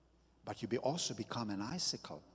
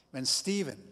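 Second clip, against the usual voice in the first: ordinary delivery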